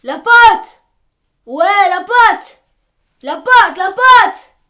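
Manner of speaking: loud shouted call across the street. Real, not acted. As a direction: yell his name to get him outside. Calling a friend outside.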